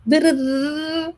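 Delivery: mocking nag. Parent mocking their own nagging tone, sing-song. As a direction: drone on like a nagging scold. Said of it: "Who wants that?".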